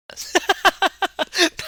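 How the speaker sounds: a sudden, bright, high laugh; high burst of laughter. Real, not acted. Laughing at his own joke to viewers, high laugh. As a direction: crack up at your own line.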